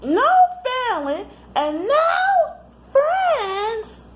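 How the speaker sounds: very high, slow sneering chant with a laugh; mocking sister. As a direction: drag it out, mocking and half laughing. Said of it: "Ramona just copied off of what she said".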